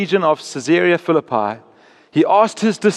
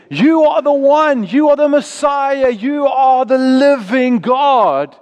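higher, stretched-out awed proclamation; epiphany exclamation; 6 semitones higher, 3 dB louder, slower than usual. Retold or imitated. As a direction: proclaim it like a sudden revelation. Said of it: "moment of epiphany where they said".

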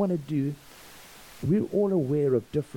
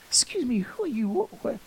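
high-pitched, urgent questioning; urgent bewilderment; 10 semitones higher than usual. Retold or imitated. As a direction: imitate a baffled man calling after a stranger. Man runs after him, baffled.

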